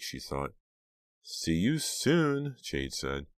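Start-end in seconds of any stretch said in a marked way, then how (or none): 1.2-2.6 very slow, high, stretched words; drawn out. Acted. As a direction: stretch every word long. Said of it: "make each word about three syllables long".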